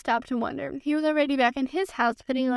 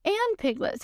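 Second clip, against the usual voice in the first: higher eager exclaim; a touch of excitement, higher; excitedly; 7 semitones higher, slower than usual. A narrator's reading. Christopher Robin hearing Pooh sing, excited. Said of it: "said Christopher Robin excitedly".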